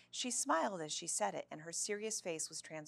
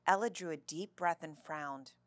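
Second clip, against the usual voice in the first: plain reading voice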